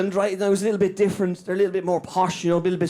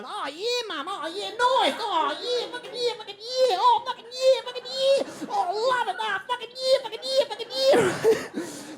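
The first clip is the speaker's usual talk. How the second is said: high squealing fucking yeah; Aussie surfer kid. Retold or imitated. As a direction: squeal it like an excited dolphin kid. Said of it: "And they go".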